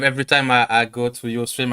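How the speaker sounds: normal talking voice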